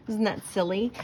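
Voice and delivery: ordinary delivery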